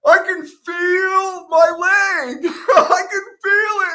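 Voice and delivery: high, loud, tearful cry of relief; crying out in pain. Retold or imitated. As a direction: sob it out half laughing, overwhelmed. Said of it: "And I'm, like, crying".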